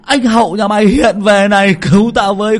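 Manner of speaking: very high, shrieking cries for help; running and screaming in terror; panicked scream. Acted. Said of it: "vừa chạy vừa hét". (vừa chạy vừa hét thất thanh)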